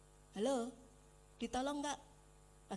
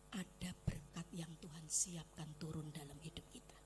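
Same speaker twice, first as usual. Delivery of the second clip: much softer, lower whisper; 7 semitones lower, 10 dB quieter than usual. Real, not acted. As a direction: share it like a secret. Hushed secret in sermon.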